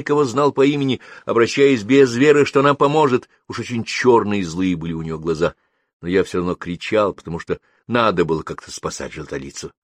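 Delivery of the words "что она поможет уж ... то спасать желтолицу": usual speaking voice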